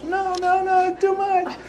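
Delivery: panicked woman. Retold or imitated. Healer voicing frightened client begging him to stop, high panicked squeak. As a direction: pitch way up like a scared woman pleading.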